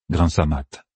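usual speaking voice

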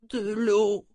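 put-on high, strained plea from a sick old man; parched, weak. A narrator's reading. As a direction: beg with a dry mouth. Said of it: "tenta en vain de s'humecter les lèvres". (voix faible, bouche sèche)

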